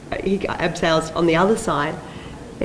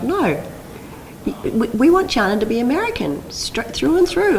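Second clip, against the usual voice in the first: higher, emphatic imitation of a boss; insistent producer; 7 semitones higher than usual. American producer demanding the character stay American, insistent high voice. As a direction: climb in pitch and stretch the last words, insisting.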